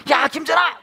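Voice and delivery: put-on high, loud yell at a child; angry dad. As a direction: shout the child's name, fed up. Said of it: "너무 화가 나는 거예요". (화난 아빠가 버럭 부르듯)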